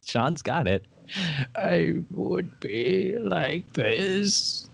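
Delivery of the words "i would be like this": put-on very slow, high, creaky old man. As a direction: drag it out like a frail old man. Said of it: "old man voice".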